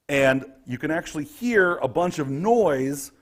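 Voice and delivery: ordinary talk, nothing marked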